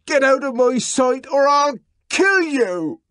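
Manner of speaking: enraged man standing over the woman, shouting; furious shout. Acted. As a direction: drive her out with one shout. John threatening Lenina.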